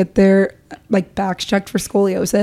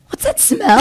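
high-pitched mocking quote; exaggerated high query; mock disgusted question; 12 semitones higher than usual. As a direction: imitate a grossed-out classmate, pitched way up. Acts out classmates noticing barf.

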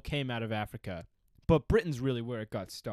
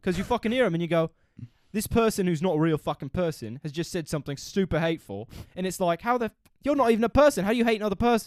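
higher, louder British rant; British bloke accent; 4 semitones higher, 4 dB louder than usual. Pretend. Acting out a bloke hearing hate, indignant British accent. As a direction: build to an outraged British tirade. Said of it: "You're doing a pretty good one".

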